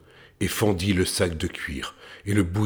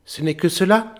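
high scornful voice; scoff, pitched up high; scoffing little tailor; 11 semitones higher, 4 dB louder than usual. A narrator's reading. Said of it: "dit le petit tailleur".

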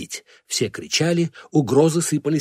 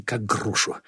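ordinary delivery

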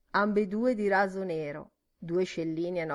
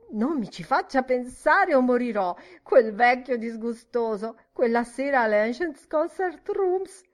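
high, loud, overwrought begging; renewed pleading; 5 semitones higher, 6 dB louder than usual. A narrator's reading. Miss Kennedy begging not to think of that evening, overwrought pleading. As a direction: plead as if it might kill you. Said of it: "ma pregò ancora".

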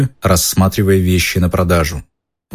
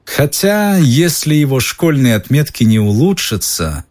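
even colder; 7 semitones higher than usual. A narrator's reading. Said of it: "ещё холоднее сказал мистер Малфой".

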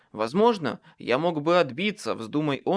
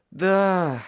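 loud exhaled assent, pitched up; breathed-out yes; 5 semitones higher, 6 dB louder than usual. A narrator's reading. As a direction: let it out on a heavy sigh. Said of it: "выдохнул я". (на выдохе)

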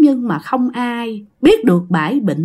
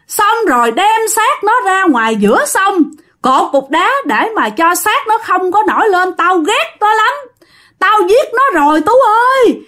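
possessed confession; 8 semitones higher than usual. Retold or imitated. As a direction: rattle off the crime as if compelled, rising into terror. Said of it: "bà nói giống như ai khiến vậy đó".

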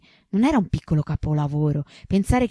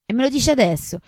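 surprised; 7 semitones higher, 5 dB louder than usual. Acted. Incredulous retort.

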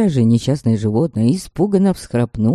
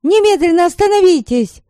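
high, loud frantic yell; frantic shout; 13 semitones higher, 5 dB louder than usual. A narrator's reading. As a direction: scream it in panic to stop. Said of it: "Заполошно закричала я".

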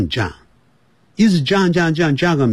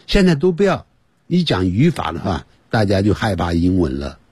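usual speaking voice

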